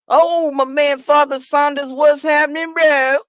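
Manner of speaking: high, loud hail to a friend; excited shouted greeting. Real, not acted. Calling out greeting to Father Saunders.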